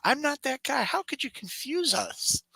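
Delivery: high, incredulous retold protest; mock indignant disbelief. To the shot-buying guy, high mock outrage. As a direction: squeak out the outrage at being mistaken.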